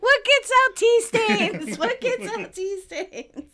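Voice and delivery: high-pitched playful yell, loud at first; mock yelling. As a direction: call it out in pretend panic. Joins in the yelling impression.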